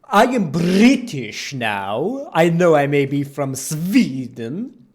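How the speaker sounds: slow, loud put-on British accent with Swedish sounds; mock Swedish-British accent. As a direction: drag it out like a pompous fake Brit from Sweden. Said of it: "accent like that instead of his fucking bore".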